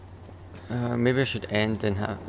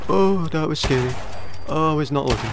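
shaken; 4 semitones higher than usual. Real, not acted. Shaken after jump scare.

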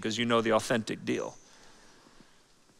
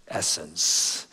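hushed essence; 10 semitones lower, 6 dB quieter than usual. Acted. Dropped key word in sermon.

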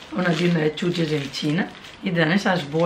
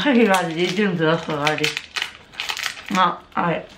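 talking while picking at teeth; mouth full of sticky candy. Real, not acted. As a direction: fingers at lips, chewing. Seen 0: Woman eats candy, fingers at mouth picking stuck piece while talking.

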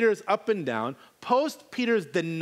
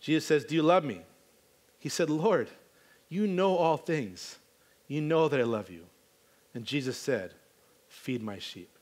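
hushed scripture reading; 4 semitones lower than usual. Acted.

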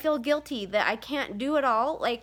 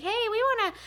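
put-on kids asking; 12 semitones higher than usual. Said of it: "and they're like".

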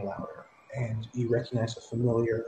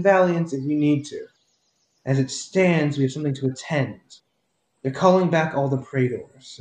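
commanding woman; 2 semitones higher, 5 dB louder than usual. Acted. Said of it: "you hear the female voice go".

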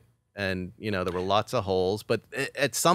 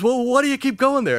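much higher, louder mock disbelief; incredulous boss; 7 dB louder than usual. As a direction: play the baffled producer. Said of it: "And he goes".

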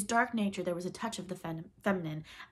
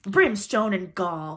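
loud, slow thundered oath; thundering; 11 dB louder, much slower than usual. A narrator's reading. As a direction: thunder it as an enraged pirate captain. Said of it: "thundered Hook".

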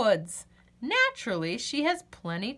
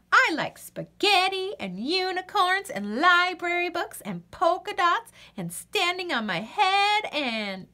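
higher, bubbly nonstop list; chattering excitedly; 7 semitones higher than usual. Acted. Wordy Birdie gushing about everything she likes, excited. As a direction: rattle it off without a breath.

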